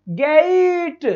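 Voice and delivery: गेट drawn out as "geeiit"; teacher's long gliding गेट; audible i sound. Shown as a demonstration. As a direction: stretch the vowel of गेट from e into i. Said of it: "क्योंकि ई की sound भी आ रही है".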